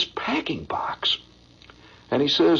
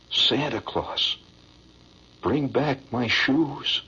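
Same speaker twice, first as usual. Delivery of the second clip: poem recitation; 8 semitones lower than usual. Acted. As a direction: recite it like a heart-tugging stage ballad.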